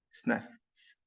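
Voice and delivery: "sniffed" said softly as "snift"; final ED as T. Shown as a demonstration. Teacher contrasting base and past, soft "sniffed" with T ending. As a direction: end "sniffed" on a voiceless T. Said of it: "بننطق ال final E D as".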